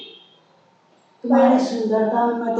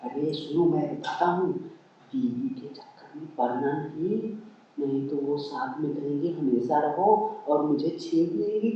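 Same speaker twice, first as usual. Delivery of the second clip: low, softer cautioning voice; confidential warning; 4 semitones lower, 7 dB quieter than usual. Acted. Husband warning wife about his sister-in-law, low and confiding. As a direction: take them aside and warn them quietly.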